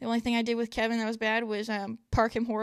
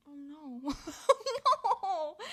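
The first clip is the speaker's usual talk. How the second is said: laughing mock dismay; 4 semitones higher than usual.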